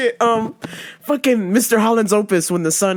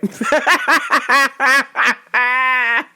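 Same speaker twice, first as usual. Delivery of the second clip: long cackling fit; high rolling laughter; helpless laughter; 4 semitones higher than usual. Real, not acted. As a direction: crack up hard at the punchline. Cracks up at the punchline.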